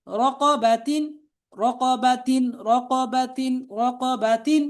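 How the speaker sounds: level, measured chant with drawn-out letter names; spelling drill recitation. Shown as a demonstration. Teacher spelling out Arabic words for learners to repeat, chanted drill.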